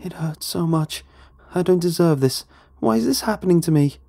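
high-pitched, anguished woman's voice; begging woman. A narrator's reading. Unseen woman in pain to the trapped man, begging. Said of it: "she begged".

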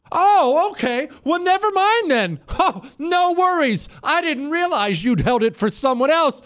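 high, sugary fake cheer, dripping with sarcasm; mock-gracious to the agent who held the plane for others, sarcastic; sarcastic mock politeness. Traveller mocking the gate agent with a high, syrupy, sarcastic cheer.

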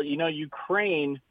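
normal talking voice

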